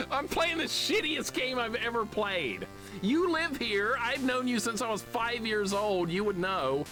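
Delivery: high-pitched, incredulous complaint; exasperated rant. Real, not acted. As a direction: protest to a friend, voice way up. Exasperated rant about terrible game.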